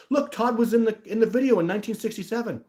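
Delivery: high, loud excited imitation; mock believer. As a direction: parody someone announcing a discovery. Acts out absurd believer.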